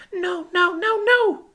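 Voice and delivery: very high, small voice in denial; tiny high-pitched distressed girl; distraught child. A narrator's reading. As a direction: refuse to believe it, horrified. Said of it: "as she wildly shook her head".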